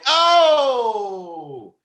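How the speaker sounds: long, high shouted "ohhh"; one huge drawn-out hype yell falling at the end; hype guy's "oh". Acted. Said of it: "he says, "Oh," for me".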